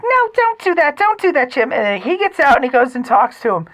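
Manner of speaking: high, affected mother storyteller; mom's affected voice. Retold or imitated. Host retells mother's Florida encounter in her annoying affected voice. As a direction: keep up the exaggerated high mom voice. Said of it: "without the annoying affected, uh, voice".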